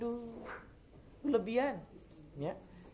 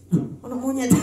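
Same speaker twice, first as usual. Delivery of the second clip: high, louder startled yelp; startled cry; 7 semitones higher, 5 dB louder than usual. Retold or imitated. Worshipper startled mid-prayer, high yelp. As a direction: jump at the sight of a monkey. Praying man spots a monkey.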